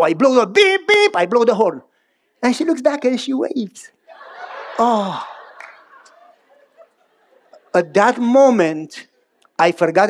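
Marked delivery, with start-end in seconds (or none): none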